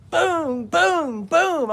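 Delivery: high loud booms; door pounding. Retold or imitated. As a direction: bang out each boom like knocking.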